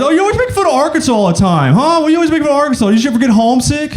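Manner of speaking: high, fast mock complaint; mock complainer. Arkansan griping at the comedian about jokes, high and fast. Heckling Arkansans voiced in the bit.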